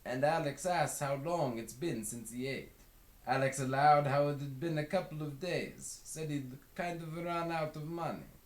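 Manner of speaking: casual retelling in a character voice; Westerberg's voice. A narrator's reading. Westerberg recounting the hitchhiker's first day, casual. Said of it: "says Westerberg".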